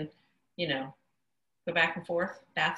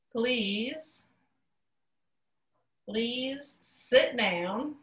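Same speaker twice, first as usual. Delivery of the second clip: slow demo while signing; 5 semitones higher, much slower than usual. Shown as a demonstration.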